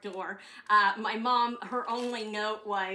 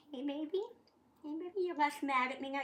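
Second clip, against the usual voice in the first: pet talk; 6 semitones higher, faster than usual. Real, not acted. Talking to her pet.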